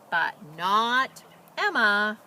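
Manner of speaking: usual speaking voice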